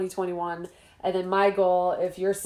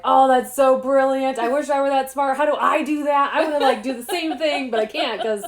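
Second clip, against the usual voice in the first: excited gush; 5 semitones higher, 5 dB louder than usual. Retold or imitated. Author retelling her awe at a book's ending, mock excited gush. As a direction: replay her own starstruck reaction, a bit hammed. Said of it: "I was like".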